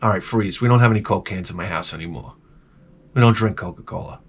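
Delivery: low, quiet dry interruption; understated low deadpan. Retold or imitated. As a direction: cut her off flatly and quietly. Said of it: "And I'm like".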